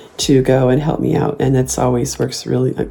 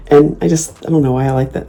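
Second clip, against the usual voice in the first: ordinary talk, nothing marked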